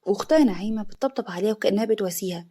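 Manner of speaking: ordinary delivery, nothing marked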